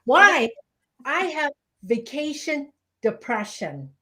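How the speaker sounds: ordinary talk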